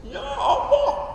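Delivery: high, slow, drawn-out plea; hammed-up wail to make them cry; mock tearful prayer. Preacher tests making the congregation cry, mock wailing. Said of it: "Saya tes".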